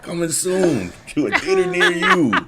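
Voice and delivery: high, loud hammy trailer voice; movie trailer announcer. Pretend.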